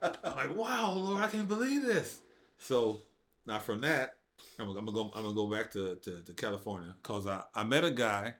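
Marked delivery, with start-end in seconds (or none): 0.6-2.2 amazed. Retold or imitated. Amazed prayer to God.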